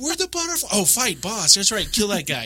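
high-pitched, easily sidetracked chatter; hammed-up excited babble; mock distracted excitement. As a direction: play a dazzled newbie losing focus mid-fight. Acts out distracted self during fight.

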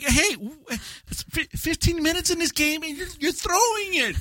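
high, squeaky-pitched playful protest; mock exasperated spectator. Spectator to friend throwing a long game, mock exasperated. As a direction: complain up high at a bungling player.

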